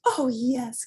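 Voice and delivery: put-on high, eager kids' reply; excited cry. A narrator's reading. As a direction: cry out your eager yes. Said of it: "cried the kids".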